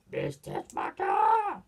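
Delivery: very high, drawn-out imitation shout; mock cry. Character crying out the name, mock cry. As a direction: stretch the name into a put-on wail.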